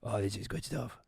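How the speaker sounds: very soft, low producer aside; hushed, low, gleeful aside; confidential aside. Retold or imitated. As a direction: lean in and murmur it secretly to a colleague. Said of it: "leaning over to the other camera".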